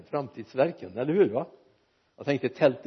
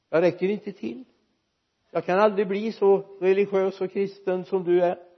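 doubting churchgoer; 5 semitones higher than usual. Retold or imitated. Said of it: "människor som sa att ja".

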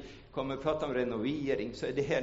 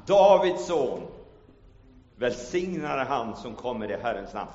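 preaching Bible reading; 3 semitones higher, 8 dB louder than usual. Acted. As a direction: proclaim the Gospel to the church. Reads Gospel aloud in preaching voice.

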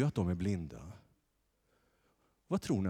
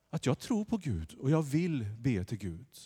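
ordinary talk, nothing marked

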